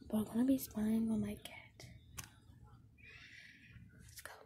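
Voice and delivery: hushed, spying whisper; sneaky whisper. Real, not acted. Sneaking up to spy on cat.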